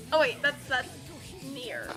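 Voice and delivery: ordinary talk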